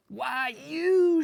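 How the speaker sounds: high loud outraged shriek; shocked lady shrieking. A narrator's reading. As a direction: shriek it like a prissy lady insulted. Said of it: "she shrieked".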